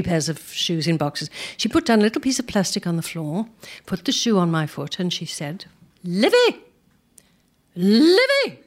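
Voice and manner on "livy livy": high, raised imitation of a command; urging upward. Shoe fitter urging dancer up, imitated high command. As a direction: call it out, pitch shooting up. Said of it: "waving her hands in the air to go up".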